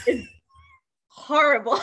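high, tearful outburst with laughter; tearful breakdown. Real, not acted. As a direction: wail it, half laughing. Overcome by the death scene.

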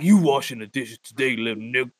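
put-on deep voice of a girlfriend giving orders; bossy buff woman. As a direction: talk down low like she owns him. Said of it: "put your ass in the head like".

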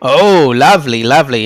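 ordinary talk

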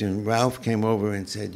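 usual speaking voice

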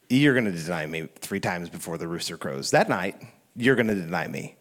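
low, soft, matter-of-fact prediction; quiet knowing reply. Retold or imitated. Jesus tells Peter of his denial, quiet and knowing.

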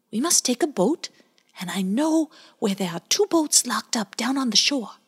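high, eager little otter voice; a small critter helping out; baby otter Bowie. Acted. Bowie explains the boats, high eager otter voice.

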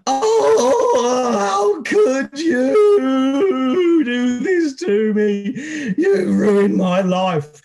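high, slow wailing cry; mock sobbing scream, drawn out and high. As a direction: wail it out like a heartbroken woman. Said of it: "this woman who was screaming".

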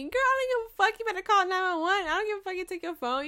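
high, loud, hammed-up brush-off; loud comic outrage; sassy mock refusal. Acts out her refusal to help.

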